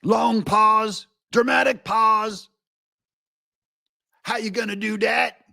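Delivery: high, declaimed caricature; mock pundit oratory. Host mocking the pundit's pauses, hammed-up oratory. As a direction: announce each bit like grand oratory. Mimicking the pundit's dramatic delivery.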